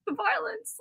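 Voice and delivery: high, soft mock horror; mock appalled squeamishness. Daughter imitating mom watching Home Alone, squeamish mock horror. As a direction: imitate someone who can't bear to watch. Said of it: "put her hands like this and be like".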